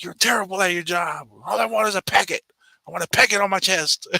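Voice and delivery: high, mock shouting, irate caller; imitating an irate customer's shout; mock angry customer. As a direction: yell at the clerk, hammed-up. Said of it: "He's like".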